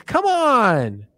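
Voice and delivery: a victim who can't believe it; outraged disbelief. Acted. Hell victim complaining to Pinhead, high outraged squeal.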